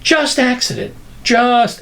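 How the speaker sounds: high, sarcastic wonder; mock wonder. Mocks the idea of chance.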